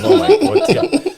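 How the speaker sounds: high laughing oh my God; laughing disbelief. Retold or imitated. Shocked teammate laughing at a teammate's threat. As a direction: squeal it through a laugh.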